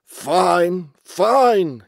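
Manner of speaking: put-on loud, drawn-out old-boss voice; grunting old chief. A narrator's reading. Old walrus chief approving Basil's report, loud grunting voice. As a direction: boom it out like a pompous old leader. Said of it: "The old man grunted".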